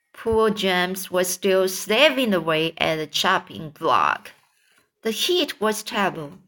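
plain reading voice

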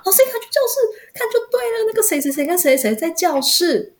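put-on a kid begging the teacher to come now; urgent student. Student urging, retold again. (高声急切的学生语气)

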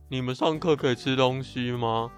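ordinary delivery

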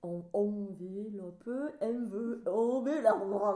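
"envelope" practised slowly with odd sing-song stresses; exaggerated clumsy French attempts at "envelope"; weird stress. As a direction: fumble "envelope" syllable by syllable, restarting halfway. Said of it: "那个重音也很怪，全部都很怪".

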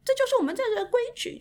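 尖着嗓子模仿老板发火; 调子高高扬起，又快又冲地顶回去; mock angry boss. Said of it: "然后他就火了". (高声模仿食堂老板的火气)

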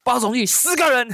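high, bold reply to the boss; host boldly telling the boss ten winners, pitched high; bold announcement. Retold or imitated. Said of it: "我就说".

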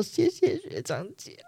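mock-teary thanks to the seniors, soft and high; tearful thanks. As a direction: thank them while still crying, slowly and softly. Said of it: "我就真的是哭着走掉的".

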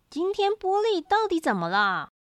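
mildly fretting rabbit mom; puzzled mother. A narrator's reading. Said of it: "兔妈妈真伤脑筋".

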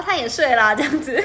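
loud, high, giggly teasing; laughing. Real, not acted. Co-host teasing about checking a crush before bed, laughing. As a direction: laugh through the tease.

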